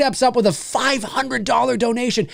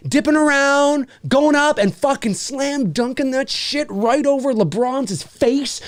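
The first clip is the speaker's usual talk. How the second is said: ordinary talk, nothing marked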